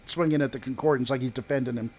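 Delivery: ordinary talk, nothing marked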